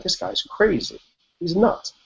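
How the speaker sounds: he can't be serious; incredulous. Retold or imitated. Patient reading the surgeon's email, amused disbelief. Reacting to surgeon's run invitation.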